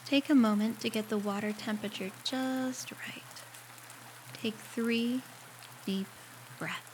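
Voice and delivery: guided meditation. Acted. Guiding a listener through a shower meditation, calm and unhurried. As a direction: ease the listener gently through each step, leaving space to breathe.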